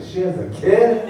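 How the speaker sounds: high, slow mock shock; a lightly voiced shocked mom. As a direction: act the startled mother. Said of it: "She's like".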